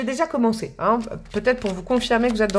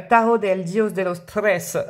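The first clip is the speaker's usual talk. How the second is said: slow, playful attempt at Spanish accent; slower than usual. Shown as a demonstration. Tarot reader apologizing as she names the deck, Spanish accent. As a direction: read the card deck's name with a Spanish accent. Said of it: "l'accent espagnol".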